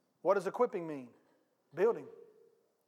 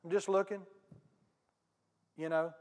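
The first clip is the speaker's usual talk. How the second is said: ordinary talk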